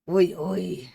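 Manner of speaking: loud, playful French exclamation; mock French. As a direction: toss out some jokey French. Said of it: "Is it French or am I tripping?".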